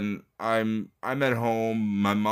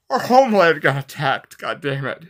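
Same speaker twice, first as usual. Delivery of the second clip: loud and higher, the anger bursting out; real angry outburst; 5 semitones higher, 9 dB louder than usual. Real, not acted. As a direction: shout it out in real fury over the attack. Angry outburst remembering the attack.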